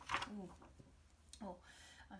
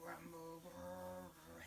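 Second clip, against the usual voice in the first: tummy rumble; 4 semitones lower, 9 dB quieter, slower than usual. Shown as a demonstration. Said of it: "can you go rumble".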